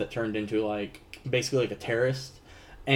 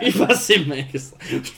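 laughing echo; 3 semitones higher, 4 dB louder than usual. Pretend. Echoes the line laughing.

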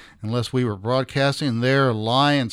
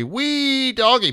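excited whoop; 11 semitones higher, much slower than usual. Real, not acted. Celebrating show's anniversary.

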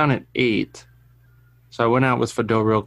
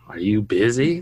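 put-on sassy toddler; 4 semitones lower than usual. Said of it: "Why do you gotta say it like that?".

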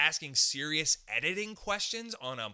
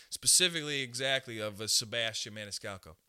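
mock comic voice; 6 semitones lower than usual. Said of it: "none of my friends sound like this".

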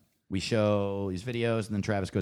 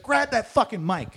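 high, louder mock command; barked order; 12 semitones higher, 9 dB louder than usual. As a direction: snap the order at him. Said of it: "He's like".

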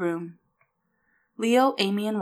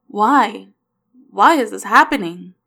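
loud, distraught outburst; raised voice, demanding and upset; anguished yell; 3 semitones higher, 10 dB louder than usual. A narrator's reading. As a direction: yell it out in despair. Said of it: "Will yelled".

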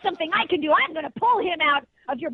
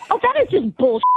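angry outburst; 5 semitones higher, 5 dB louder than usual. Real, not acted. Mom outraged at prank caller.

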